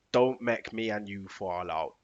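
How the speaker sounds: slow, measured parental warning; stern dad. Retold or imitated. Dad to younger son, slow stern warning. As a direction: imitate a father's warning, drawn out word by word. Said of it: "My dad used to say to me".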